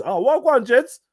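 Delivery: high, loud, hammed-up wannabe slang. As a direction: send up an outsider trying too hard with Wagwan. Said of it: "someone that, that says".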